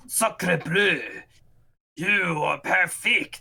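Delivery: light mock French accent, slow; a hint of a hammed-up Frenchman. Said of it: "is like".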